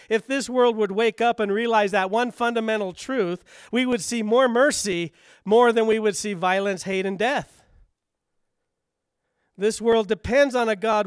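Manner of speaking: preacher crying out that the world needs mercy; impassioned preaching. Acted. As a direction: preach it out with full force.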